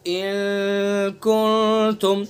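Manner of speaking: इन कुंतुम slow and long, the n hidden in the nose; nasal ikhfa. Shown as a demonstration. Teacher showing the right nasal reading, slow recitation. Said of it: "नून साकिन है".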